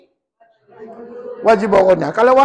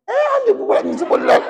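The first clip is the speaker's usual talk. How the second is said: laughing; 13 semitones higher than usual. Real, not acted. Student mispronounced again.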